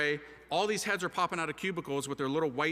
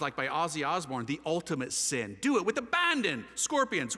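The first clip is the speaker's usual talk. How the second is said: ordinary talk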